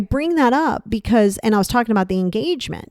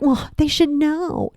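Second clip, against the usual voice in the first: whiny entitlement; 7 semitones higher, slower than usual. Retold or imitated. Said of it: "Stop being like".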